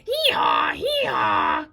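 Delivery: very high, loud donkey bray; harsh bray. Acted. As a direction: shriek the bray out.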